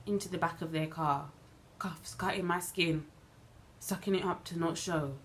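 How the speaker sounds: usual speaking voice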